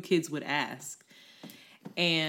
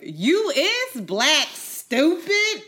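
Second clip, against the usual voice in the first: much higher, loud, slow mocking kid voice; mocking classmate; 11 semitones higher, 9 dB louder, much slower than usual. Classmate taunting her, high and loud mocking. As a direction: tease her like a sassy schoolgirl. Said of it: "Sharday Williams was like".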